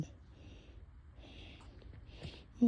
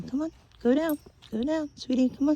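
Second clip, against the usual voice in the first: higher, louder coaxing call; coaxing the dog; 8 semitones higher, 7 dB louder than usual. Real, not acted.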